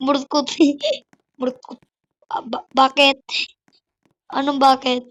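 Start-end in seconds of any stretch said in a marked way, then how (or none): none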